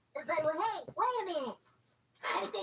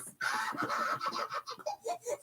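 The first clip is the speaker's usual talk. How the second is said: helpless giggle; 10 semitones higher than usual. Acted. Cracking up on phone.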